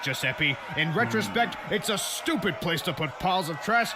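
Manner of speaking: usual speaking voice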